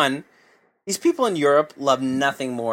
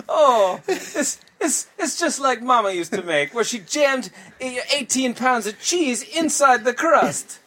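jolly Italian pizza chef; 9 semitones higher, slower than usual. Pretend. Continues the Italian bit.

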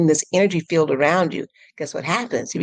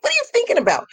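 much higher, playful question to the mind; teasing mock question; much faster than usual. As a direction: tease your wandering thoughts. Teasing her own mind.

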